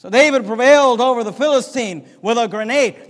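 ordinary talk

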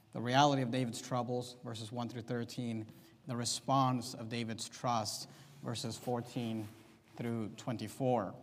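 normal talking voice

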